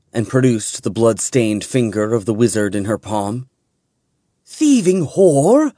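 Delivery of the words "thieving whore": high, loud, slow outraged scream of an insult; furious shout. A narrator's reading. Riljana attacking Quesson, furious shout. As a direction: spit the insult as she charges. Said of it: "the human shouted".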